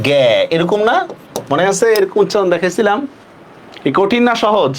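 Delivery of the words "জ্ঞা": জ্ঞা with hard g and open ae vowel; shown pronunciation. Shown as a demonstration. Teacher reminds students of the rule, sounding out জ্ঞা as 'gae'. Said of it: "তাহলে এটার উচ্চারণ হবে".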